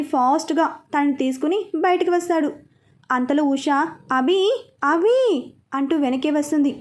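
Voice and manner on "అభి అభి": calling after someone, voice rising; lightly acted call to make him stop. A narrator's reading. Usha following Abhi out, calling after him. Mother calls after departing son.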